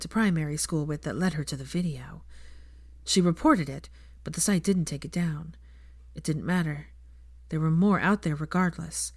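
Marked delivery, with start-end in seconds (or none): none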